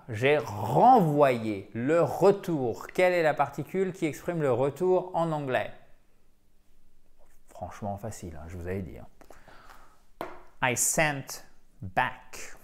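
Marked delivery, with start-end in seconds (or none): 0.1-2.9 "J'ai renvoyé le retour" dragged out very slowly; very slow model phrase. Shown as a demonstration. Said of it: "vous allez me dire". 10.6-12.6 "I sent back" said very slowly with a short a in "back"; slow down and clip the vowel of "back". Shown as a demonstration. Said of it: "avec un petit A court".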